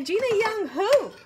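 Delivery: high, loud mock puzzled question; a friend asking who Gina Young is, high and mocking. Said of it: "And they say".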